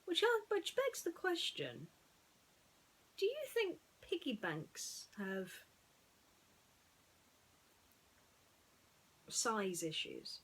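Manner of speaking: high, slow, mock-puzzled musing; playful wondering. Real, not acted. Vlogger jokingly wondering about piggy banks, high mock musing. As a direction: pose a silly question with wide eyes.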